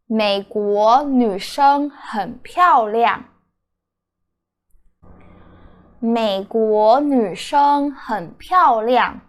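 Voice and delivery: 美国女生很漂亮 said slowly and clearly; slow demo sentence. Shown as a demonstration. Teacher slowly reading the example sentence. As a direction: model the sentence at learner pace. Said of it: "The first sentence is".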